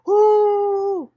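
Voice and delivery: long, loud high mock crowd "ohh"; crowd reaction "oh". Audience reacting to her question, big loud "oh". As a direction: imitate a whole audience reacting with a drawn-out hoot. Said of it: "huge reaction from the audience".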